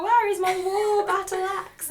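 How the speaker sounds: high, indignant mock dwarf demand; Gimli. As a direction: play a dwarf hunting for a lost weapon. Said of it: "then he'd be like".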